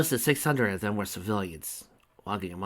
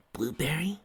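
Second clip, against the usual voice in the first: wondering; 7 semitones higher, 4 dB quieter than usual. Acted. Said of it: "she wondered aloud".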